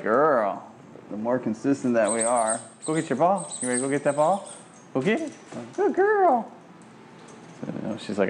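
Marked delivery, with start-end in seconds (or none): 2.8-6.5 high, eager urging; excited fetch cue; excited dog talk. Real, not acted. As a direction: pump the dog up.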